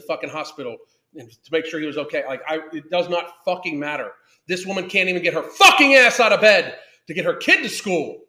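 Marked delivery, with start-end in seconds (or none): 4.5-8.2 louder, exasperated rant; real angry outburst. Real, not acted. Ranting about a mother who won't get up, angry and loud. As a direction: raise your voice in real outrage.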